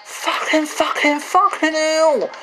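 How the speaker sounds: triumphant yell. Real, not acted. Gamer finally nails the jump, shouting in relief. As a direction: yell it out like you finally made it. Continued celebratory swearing.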